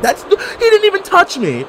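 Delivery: high, outraged disbelief; high indignant squeal. Real, not acted. Gamer protesting a fake hit, high squeal.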